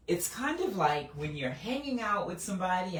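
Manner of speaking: ordinary delivery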